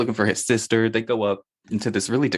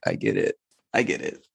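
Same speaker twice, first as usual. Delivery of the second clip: mock low voice; 9 semitones lower than usual. Friend voicing her own reaction to the shirtless hero, low. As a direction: drop the voice low and knowing.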